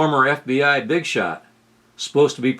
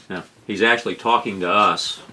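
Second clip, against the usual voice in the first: ordinary talk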